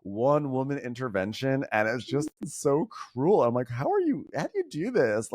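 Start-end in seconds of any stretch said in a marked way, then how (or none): 3.7-5.3 much higher, pleading in disbelief; exasperated disbelief. Retold or imitated. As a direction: ask it like you truly can't fathom it. Exasperated quote to Shannon.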